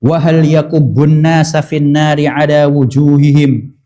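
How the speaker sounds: measured, slow, reverent reading of the Arabic; slow Arabic hadith recitation. Acted. Preacher recites the hadith to the congregation, slow and reverent. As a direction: recite the hadith slowly and solemnly.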